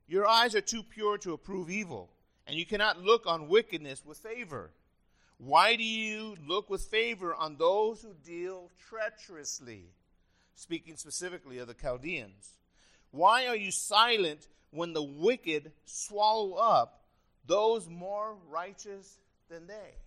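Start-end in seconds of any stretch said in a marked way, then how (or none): 0.1-9.9 loud, declaimed appeal to God; scripture reading. Acted. Preacher reading Habakkuk's challenge to a holy God, forcefully. 13.1-19.9 very slow, loud, challenging question; scripture reading. Acted. Preacher reading Habakkuk's question about the wicked, loud and drawn out.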